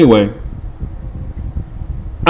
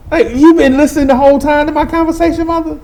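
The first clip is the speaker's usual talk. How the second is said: much higher indignant complaint; indignant retort; 13 semitones higher, faster than usual. Retold or imitated. Teen scolding his eavesdropping mother, high and indignant. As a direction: call her out, higher and fast.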